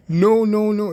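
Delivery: emphatically dismissive; emphatic refusal. Real, not acted. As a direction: burst out with a firm denial. Emphatic answer after laughing at question.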